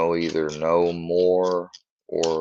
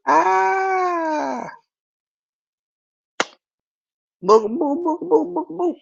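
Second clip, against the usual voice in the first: much higher, squeaky repeated calls; squeaky pet noises; 4 dB louder, much slower than usual. Real, not acted. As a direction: squeak at the dog like a toy.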